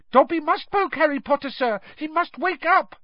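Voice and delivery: very high squeaky elf voice; high-pitched, earnest little elf; Dobby. A narrator's reading. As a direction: squeak with urgent insistence. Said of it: "squeaked Dobby".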